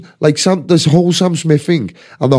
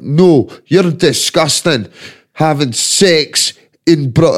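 exaggerated slow Scottish accent; mock an outraged Scot, stretching the words; [name]; much slower than usual. Scottish man catching someone in the act, hammed-up Scottish accent. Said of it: "in his jock accent".

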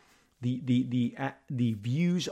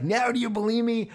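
put-on much higher, teasing teen voice; triumphant son; 9 semitones higher than usual. Son gloats to his doubting dad, high teasing. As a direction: gloat like a kid who was right all along. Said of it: "And Luke's like".

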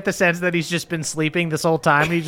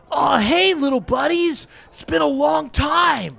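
Donkey Kong voice; 11 semitones higher than usual. Acted. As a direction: boom out a jovial ape king's hello, raised pitch. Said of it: "and he goes".

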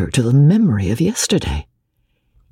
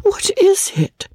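high feigned concern. Acted.

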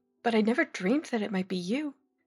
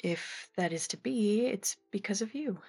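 tender gratitude; 3 semitones higher, 7 dB quieter than usual. Acted. Miss Morstan credits Watson for her fortune, gently grateful. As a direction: give the credit tenderly, unhurried.

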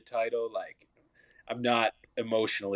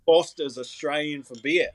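higher Australian ad-slogan voice, half joking; Australian accent; 5 semitones higher than usual. Shown as a demonstration. Guest keeps showing off his Australian accent, higher and jokey. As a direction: play the old Aussie beer ad in a jokey Australian accent. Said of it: "at my Australian accent".

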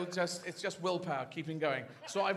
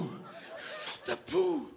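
repeated in the usual pitch; mock shock, lowered; 2 semitones lower than usual. Dog to owner after the shot, lowered mock disbelief. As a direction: drop it down after an outcry. Second take after the outcry.

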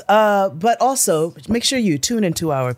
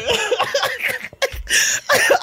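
high laugh. Real, not acted. Big laugh after story.

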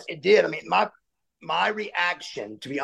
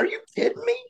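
much higher, strained cry at the teacher; unglued outburst; much faster than usual. Retold or imitated. Student losing it at teacher Miss Fay, much higher outburst. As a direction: lose it completely, pitch flying up. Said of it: "I came unglued".

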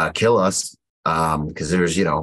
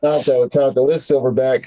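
mock radio call; 5 semitones higher than usual. Calling the supply truck over the radio, a hammed-up radio call. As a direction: hail the truck like a Marine on the radio. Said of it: "And I was like".

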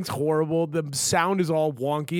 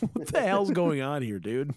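ordinary delivery

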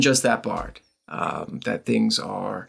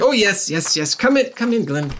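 eager welcome; 10 semitones higher, 3 dB louder than usual. Acted. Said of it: "and they're like".

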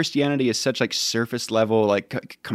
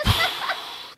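imitated weighty sigh; heavy sigh; 6 dB quieter than usual. Youth pastor reacting to a teen's written sin, sighing heavily. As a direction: let out a long, loaded breath.

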